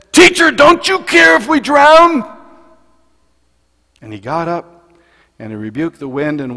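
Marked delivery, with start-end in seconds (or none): 0.1-2.2 high, loud desperate cry of panic; frantic shout. Acted. Disciples shouting at Jesus in the storm, panicked yell. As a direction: scream it like men about to drown.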